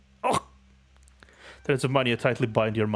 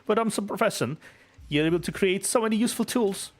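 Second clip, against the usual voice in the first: put-on high, bright little girl gushing; excited child voice; 9 semitones higher than usual. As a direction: gush like an amazed kid. Child Pepper praises inventor.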